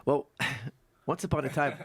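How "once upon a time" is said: laughing. Real, not acted. Laughing fairytale opener to story.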